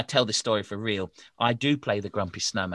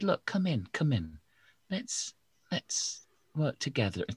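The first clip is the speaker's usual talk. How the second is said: gentle teacher voice; 6 semitones higher, 3 dB quieter than usual. Acted. Teacher inviting others in.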